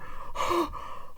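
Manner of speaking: high, sharp acted gasp; mock horrified gasp. Blonde girl sees his slit throat, horrified gasp. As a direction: suck in air in mock shock. Acting out shock at the body.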